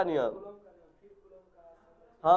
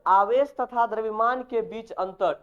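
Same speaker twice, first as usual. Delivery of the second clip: slow, clearly spaced topic title, a little higher and louder; slow dictation; 4 semitones higher, 3 dB louder than usual. Shown as a demonstration. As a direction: spell out the heading "आवेश तथा द्रव्यमान के बीच अंतर" word by word for students to write. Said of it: "ये topic खत्म करके आना". (धीमे, साफ़ लिखवाने वाले अंदाज़ में)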